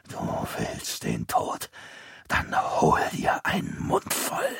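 slow, soft sinister whisper; menacing whisper. A narrator's reading. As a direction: threaten him in a deathly whisper. Dying grandpa grips boy. (drohendes, langsames Flüstern)

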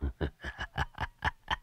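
low breathy sneer-laugh; a cold, grating little laugh; sneering chuckle. Acted. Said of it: "cười gằn". (tiếng cười gằn nham hiểm)

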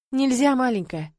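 plain reading voice

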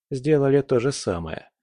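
ordinary delivery

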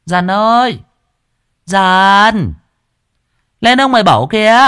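high, loud shout across the yard; calling out. Acted. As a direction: holler for the servant. Wife calls out to servant.

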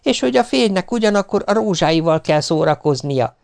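high-pitched quarrelling retort; indignant wife. A narrator's reading. Acted quarrel between spouses.

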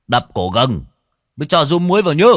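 loud call, rising high at the end; calling after. A narrator's reading. Old Tân to the departing wife, calling after her. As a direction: shout an extra instruction after someone leaving. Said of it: "Lão Tân còn nói với theo".

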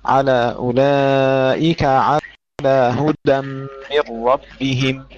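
أولئك with open kaf and هدى with a firm dal; slow model, syllables spaced out; open kaf, clear dal. Shown as a demonstration. Teacher demonstrates the correct reading for Jamila. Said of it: "افتحي الكاف وعلى هدى".

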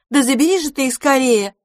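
требовательно, выше и громче; нетерпеливо потребовать. A narrator's reading. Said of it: "потребовала другая". (требовательная русалка, громко)

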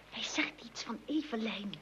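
soft unvoiced whisper aside. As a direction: whisper so the caller won't hear. Aside while holding the phone. (fluisterend opzij, weg van de telefoon)

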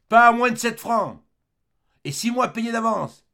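loud, high shout from the back room; man shouting. Acted. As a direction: bellow the price from afar. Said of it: "une voix d'homme cria du fond". (crier fort du fond de la gargote)

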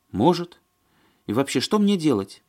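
high, helpless woman character; Маня. A narrator's reading. Woman at a loss, asking the men, plaintive.

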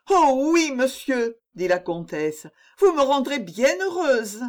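high, bright eager plea; eager pleading. A narrator's reading. Countess begging the poet to recite, eager high plea. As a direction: gush with delight at the chance.

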